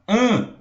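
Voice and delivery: AN as one nasal vowel, no separate N; vogal nasal. Shown as a demonstration. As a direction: say "an" as a single nasal sound. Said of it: "é um som só". ("an" como um som nasal só)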